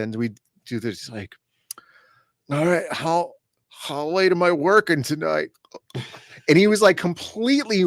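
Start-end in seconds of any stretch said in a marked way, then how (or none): 2.5-5.5 put-on drunk voice. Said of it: "slurring the words and do the drunk hiccups".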